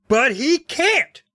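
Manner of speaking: sputtered, high and slow; high sputtering protest; high shrill sputter. A narrator's reading. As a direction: splutter it out high. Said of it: "Todd sputtered in a high, shrill voice".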